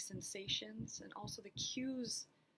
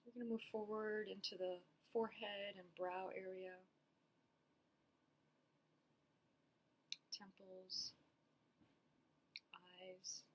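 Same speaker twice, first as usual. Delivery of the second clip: unhurried meditation guidance with long pauses; guided body scan. Acted. Therapist guiding listeners through a body scan, calm and spacious. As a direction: lead them gently through it, leaving space after each phrase.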